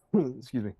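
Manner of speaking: a real cough, then excuse me. Real, not acted. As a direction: cough and apologise aside. Reader coughs mid-reading.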